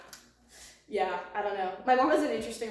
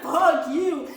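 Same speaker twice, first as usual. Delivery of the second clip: louder mock outburst; retold angry yell; 4 semitones higher, 9 dB louder than usual. Telling how she yelled at mom, mock angry shout. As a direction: yell it like you've been dropped. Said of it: "I was like".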